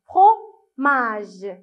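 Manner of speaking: "Fromage" split after fro; "Fromage" with a clear gap before mage; syllable by syllable. Shown as a demonstration. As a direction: separate "Fromage" as fro, then a long mage. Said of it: "Combien de syllabes fromage".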